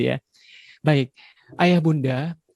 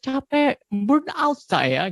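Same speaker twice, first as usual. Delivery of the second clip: exhausted complaint; 11 semitones higher, much slower than usual. Retold or imitated. Parent's excuse for yelling.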